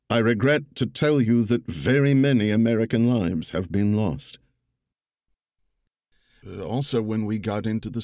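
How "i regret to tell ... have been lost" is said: louder, stately oratory, no impression; Roosevelt's cadence. Narrator reading Roosevelt's address to Congress, louder stately cadence. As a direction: give it presidential weight without mimicry. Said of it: "I embraced the cadence of it without trying to do the voice".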